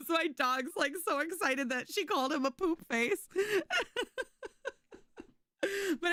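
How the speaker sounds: words squeezed out through real laughter, high; laugh-crying through the punchline; laughing hard. Real, not acted.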